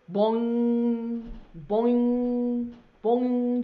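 slow, drawn-out boing like a clock striking; mock clock chime. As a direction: ring out each boing like a chiming clock. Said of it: "just like that to tell what time".

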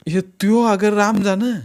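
slow, high plea of ignorance; helpless suspect. A narrator's reading. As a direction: protest helplessly, drawn out and high.

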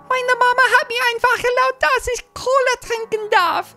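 put-on very high-pitched little German kid; tiny German voice. Shown as a demonstration. Kid whose mom allowed cola, tiny German voice. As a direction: pipe it up like a tiny German child. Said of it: "in the tiny German voice".

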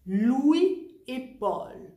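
Louis et Paul very slow with no link between Louis and et; no liaison. Shown as a demonstration. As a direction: keep "Louis" and "et" apart, no joining sound. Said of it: "Je ne fais pas non plus la liaison".

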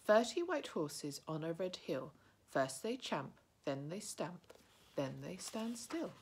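measured riddle reading; reciting riddle. A narrator's reading. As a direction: chant the riddle slowly.

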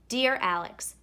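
ordinary delivery

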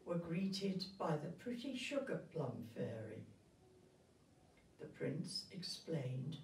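usual speaking voice

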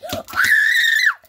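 very high, loud shriek; piercing play-fight scream. Pretend.

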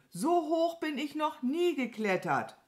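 high, proud exclamation; stolzes Rufen. A narrator's reading. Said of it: "rief Mama Mu". (stolz und hoch rufen)

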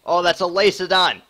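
high, loud startled cry; alarmed shout. Real, not acted. Streamer spotting a monster, shouting high and loud. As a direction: yell the discovery in real alarm.

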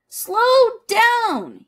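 loud high plea to slow down; honking shout. A narrator's reading. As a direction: shout after the runaway duck. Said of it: "he honked".

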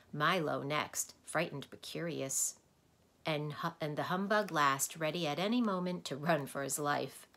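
ordinary delivery

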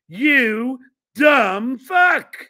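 loud, drawn-out jab; angry insult. Real, not acted. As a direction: land each word slowly and loudly. Insulting someone he mocks.

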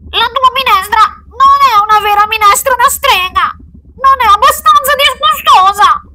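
put-on very high, loud child voice; protesting child. Child with nose held criticising the witch's soup, squealed protest. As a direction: squeal the complaint at full volume.

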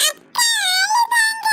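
put-on high, drawn-out childish wail; crying. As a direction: wail slowly through put-on tears. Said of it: "அழாதடி". (அழுகையோடு இழுத்துப் பேசு)

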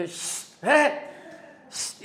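hissed shushes around a loud "eh"; imitated shushing. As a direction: act out cinema-goers hushing someone. Lo callan en el cine. (chistidos imitando al público del cine)

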